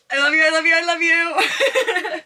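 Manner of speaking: loud, slow, laughing love you; joyful laughing. Real, not acted. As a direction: sing out love through laughter. Thanking followers after sellout.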